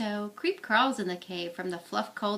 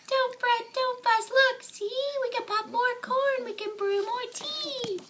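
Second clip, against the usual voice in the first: much higher, tiny squeaky mouse; mouse squeak; 13 semitones higher than usual. Acted. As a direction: squeak it out to comfort the bear. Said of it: "Mouse squeaks".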